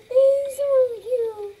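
gasp, then high, loud drawn-out gush; excited squeal. Real, not acted. Player delighted by the first horse shown, high excited squeal. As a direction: squeal it in delight, stretching the words. Gushing over a cute horse.

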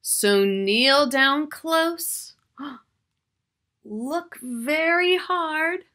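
slow storybook ending, full of wonder; reading to children. Acted. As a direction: draw the children in, slowly. Reads the book's closing lines.

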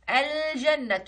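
الجنة with the ل held and clearly heard; sounded lunar lam. Shown as a demonstration. Teacher showing the lunar lam, الجنة with a long ل. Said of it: "كلمة بها لام قمرية".

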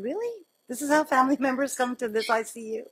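high-pitched, quick disbelieving retort; retold incredulity. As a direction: act out challenging a lame excuse, half laughing.

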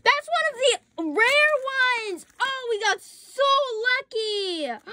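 very high, thrilled gushing; excited squeal. Real, not acted. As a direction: squeal with delight. Finds a rare one.

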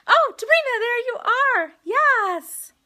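high, loud, delighted shout; excited greeting. Real, not acted. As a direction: cry out as you spot your winner. Spots the winner in chat.

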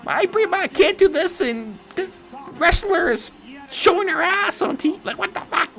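high-pitched put-on outraged parent; pissed parent. Parent complaining about a PG show, high mock outrage. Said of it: "those parents were pissed".

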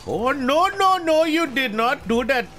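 normal talking voice

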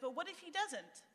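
anxious doubt. Retold or imitated. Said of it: "but in a healthy way".

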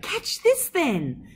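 a slow teasing shout; old man calling back. A narrator's reading. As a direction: toss the words out like a jolly old neighbour. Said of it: "had shouted back".

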